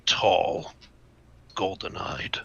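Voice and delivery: whispered mental message; hushed whisper. Acted. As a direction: whisper it slowly, word by word. Character answers over mental link.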